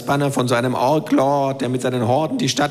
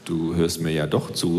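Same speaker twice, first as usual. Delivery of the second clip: surprised; 10 semitones lower, 5 dB quieter, slower than usual. Acted. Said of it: "sage ich erstaunt".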